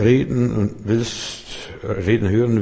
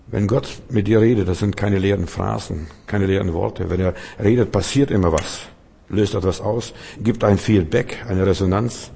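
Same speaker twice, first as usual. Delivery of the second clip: ordinary talk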